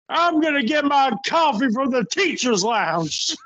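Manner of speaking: high, badly faked teacher voice; deliberately terrible wrestler impression; Larry Zbyszko impersonation. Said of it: "terrible Larry Zbyszko impersonation".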